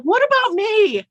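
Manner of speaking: playful jealous whine; mock jealous whine. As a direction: whine like a left-out child. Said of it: "I'm like".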